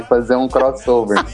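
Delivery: ordinary talk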